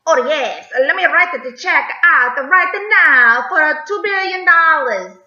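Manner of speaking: admittedly bad Italian accent as an eager Mexico. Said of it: "I know that was like an Italian accent".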